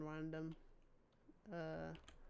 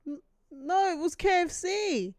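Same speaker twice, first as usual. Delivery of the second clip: indignant; 9 semitones higher, 9 dB louder than usual. Real, not acted. Streamer arguing with game.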